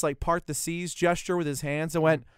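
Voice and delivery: ordinary delivery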